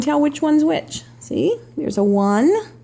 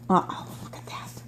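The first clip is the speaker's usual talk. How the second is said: hushed uh-oh aside; whispering; 9 dB quieter than usual. Real, not acted. Aside to children during story.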